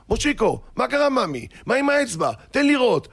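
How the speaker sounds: high, fussing parent voice; worried parent. Retold or imitated. Parents rush to the boy's hurt finger, alarmed concern.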